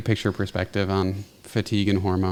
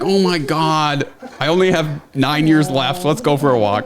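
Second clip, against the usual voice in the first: Albus; 6 semitones higher, 4 dB louder than usual. Pretend. Host speaking for the dog who wants a walk, mock whining. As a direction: voice the dog's exasperated plea. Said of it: "He's like".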